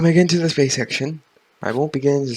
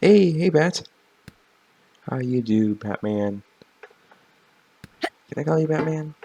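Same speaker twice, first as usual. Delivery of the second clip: normal talking voice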